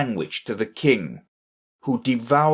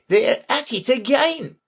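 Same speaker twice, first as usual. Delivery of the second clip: very high-pitched cry, only modestly raised in volume; mock shout; 3 dB louder than usual. A narrator's reading. Messenger shouting into the king's ear, excited. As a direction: call out the news in a high voice. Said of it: "shouted at the top of his voice".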